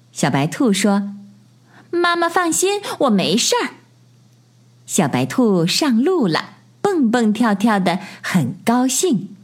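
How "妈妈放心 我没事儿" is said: high bunny voice; high-pitched, confident little bunny; little rabbit child. A narrator's reading. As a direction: reassure mum in a high child's voice. Little rabbit reassuring mother.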